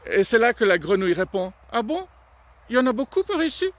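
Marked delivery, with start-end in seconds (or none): 1.7-3.7 high, quick, pinched little voice; tiny-mouth frog voice. Acted. Frog hiding its big mouth from the crocodile, high and quick. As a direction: suddenly squeeze the mouth small.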